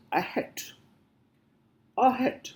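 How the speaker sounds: "Ahead" sounded out with the second syllable rising; demonstrated pronunciation. Shown as a demonstration. Teacher showing learners how to pronounce this, careful demonstration. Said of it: "how will you pronounce this".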